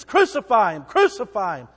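preacher voicing the crowd demanding crucifixion, loud; mob shout. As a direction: hurl it as the angry mob.

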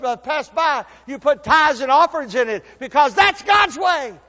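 loud, high building exhortation; fiery sermon on giving; preaching at full force. Acted. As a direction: drive it home to the shout. Preaching rises to a shout.

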